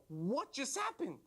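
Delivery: slow, high, drawn-out question; mock astonishment. Body parts baffled in the dream, mock astonishment.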